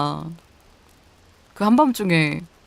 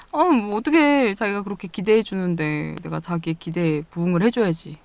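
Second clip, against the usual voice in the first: normal talking voice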